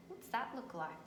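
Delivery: usual speaking voice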